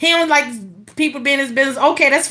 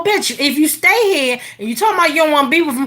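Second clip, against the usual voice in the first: usual speaking voice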